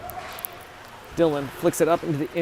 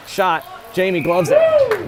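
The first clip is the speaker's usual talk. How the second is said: rising, louder shouts as the save comes; excited call; 6 dB louder, much slower than usual. Real, not acted. Commentator shouting as the goalie gloves the shot.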